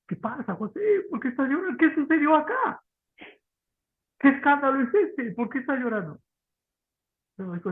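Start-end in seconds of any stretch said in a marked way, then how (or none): 0.1-6.2 alarmed courtiers. Retold or imitated. Pharaoh's people asking, alarmed.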